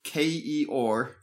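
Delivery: slow letter-by-letter spelling. Shown as a demonstration. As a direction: spell each letter out clearly, slowly. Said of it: "spell that for me there".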